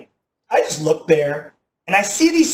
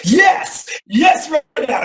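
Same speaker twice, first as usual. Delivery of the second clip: high, loud eager yes; eager calling out; 10 semitones higher, 3 dB louder, much slower than usual. Retold or imitated. Audience members answering the preacher, eager shouting. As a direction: blurt it out like an overeager student.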